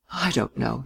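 hushed and noncommittal; subdued reply. A narrator's reading. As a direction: say it quietly, giving little away. Guarded reply to teasing.

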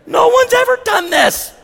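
high, far voice of a tearful woman; mock weeping Karen. Karen thanking the pastor, high and hammed-up tearful. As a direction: ham up a grateful sobbing lady. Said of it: "some of them come up weeping".

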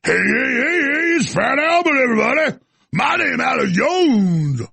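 high, drawn-out cartoon holler; exaggerated put-on church-crowd greeting, stretched long; Fat Albert. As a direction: boom out a hammed-up cartoon catchphrase.

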